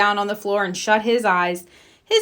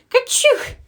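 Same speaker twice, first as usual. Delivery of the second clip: sudden high sneeze sound. A narrator's reading. Peter hiding in the watering can gives himself away, high sneeze. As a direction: burst out with a squeaky little sneeze. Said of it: "Then Peter sneezed".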